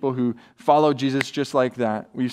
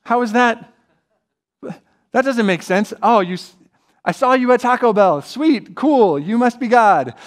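mock disbelief; 8 semitones higher, 5 dB louder than usual. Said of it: "And we're like".

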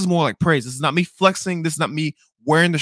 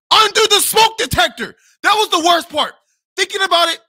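high, loud incredulous outburst; exasperated high-pitched yell; outraged disbelief; 13 semitones higher, 7 dB louder than usual. Real, not acted. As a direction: exclaim it as the worst offence, voice way up. Outraged at the worst part.

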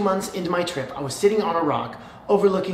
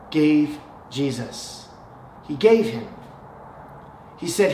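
normal talking voice

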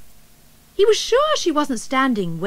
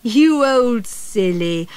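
slow, fond comfort; mother hugging her lost cub; tender teasing; 4 dB louder, slower than usual. A narrator's reading. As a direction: soothe the frightened cub.

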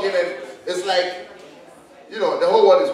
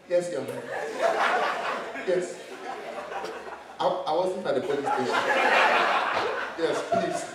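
meek witness; 3 semitones lower, 10 dB quieter than usual. Retold or imitated. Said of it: "when you enter, you mellow".

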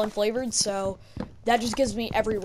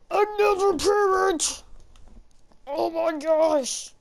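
slow, high goofy cartoon voice; silly voice; 6 semitones higher, slower than usual. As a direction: drag it out in a silly put-on voice. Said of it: "Don't ask why I'm speaking like this".

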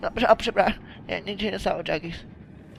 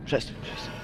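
1 whispered plea; 10 dB quieter than usual. Real, not acted. Pig attacks.

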